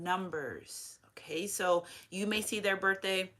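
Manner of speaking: soft secretive whisper, like sharing a secret. Real, not acted. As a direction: drop to a hushed whisper for the hint. Confiding a secret hint.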